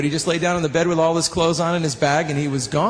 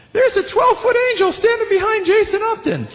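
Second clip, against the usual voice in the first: put-on high, excited child's voice; little kid's voice; 3 dB louder than usual. Little kid tells the preacher about the angel, high and excited. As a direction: pipe up like a wide-eyed small boy. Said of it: "he goes".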